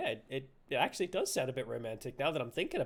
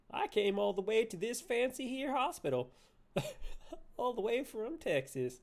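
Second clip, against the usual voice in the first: mock Southern accent, raised pitch; Texas accent; 6 semitones higher than usual. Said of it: "She's from Texas".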